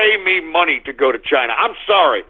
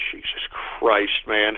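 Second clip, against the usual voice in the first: whispered, much lower and softer; exasperated mutter; 11 semitones lower, 8 dB quieter than usual. Real, not acted. Host muttering at troll names, exasperated whisper. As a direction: sigh it out under your breath.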